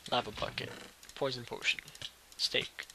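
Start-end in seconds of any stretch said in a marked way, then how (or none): none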